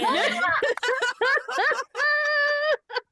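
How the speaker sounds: a long shrieking burst of laughter; loud high laugh. Real, not acted. Host losing it after a joke, loud high laughter. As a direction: lose it completely.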